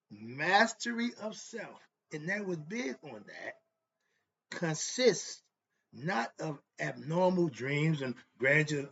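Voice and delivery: loud, slow fired-up preaching. Acted. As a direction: preach it hard to the congregation. Preaches loudly from the book.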